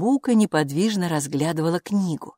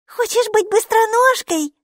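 put-on high, louder child's delight; thrilled the creature answered; 6 dB louder than usual. Майки, маленькая девочка, радостно спрашивает Буку. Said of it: "обрадовалась Майки".

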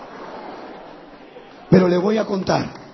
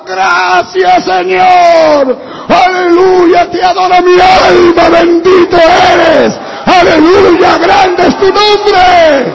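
high, drawn-out full-force shouting; ecstatic shouted praise; 10 semitones higher, 11 dB louder, much slower than usual. Retold or imitated. Husband now the one praising God, shouting at full force. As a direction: belt out worship, stretching the words. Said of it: "ahora era yo el que decía".

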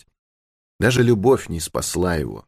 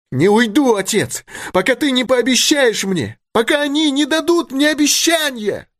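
very high, desperate insistence; defiant refusal. A narrator's reading. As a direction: refuse the father, climbing to the very top. Daughter refuses to leave. (отчаянный отказ очень высоким голосом)

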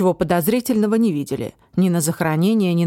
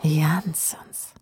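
menacing whisper; 3 semitones lower than usual. Acted. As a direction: whisper the name like a threat. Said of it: "сказал он почти ласково и так страшно".

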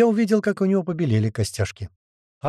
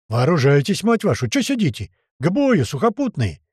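shouting; 3 semitones higher than usual. Acted. Rif urging the men to arm.

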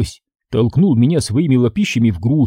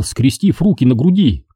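ordinary delivery, nothing marked

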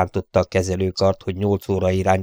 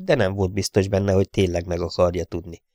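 usual speaking voice

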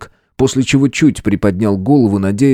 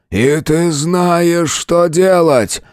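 strained shout; 7 semitones higher, much slower than usual. A narrator's reading. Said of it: "выкрикнув мне в лицо".

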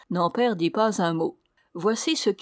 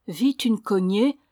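ordinary delivery, nothing marked

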